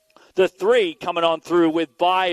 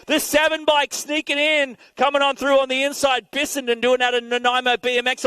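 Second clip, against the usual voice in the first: excited shout; 6 semitones higher than usual. Real, not acted. Announcer calling a surprise pass to the crowd, excited shout. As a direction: yell it out as the pass happens.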